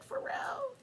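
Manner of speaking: hushed high whisper; whispered disbelief. Retold or imitated. Partner whispering in disbelief at their own mistake. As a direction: breathe the question in disbelief.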